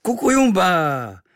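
high, loud, drawn-out war whoop; sudden shriek. Acted. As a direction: shriek the nonsense word wildly. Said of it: "z ničeho nic zaječel".

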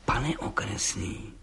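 soft, slow, sly address; vindictive. A narrator's reading. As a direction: draw it out quietly, plotting revenge. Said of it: "řekl mstivě". (mstivě, tiše a pomalu)

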